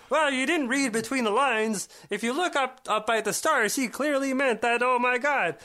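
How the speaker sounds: high, loud frog-puppet voice; Kermit the Frog. Acted. Host mocking those who blame him for literal readings, Kermit voice. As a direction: squawk out a mocking high-pitched puppet impression. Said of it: "Did you like my Kermit the Frog".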